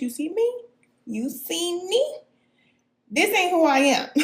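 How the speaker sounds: playful high voice. Acted. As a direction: pitch way up to tease the viewers. Playfully pointing at own face.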